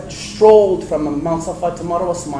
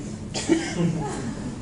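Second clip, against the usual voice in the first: breathy laugh; 10 dB quieter than usual. Real, not acted. Laughs at own accent.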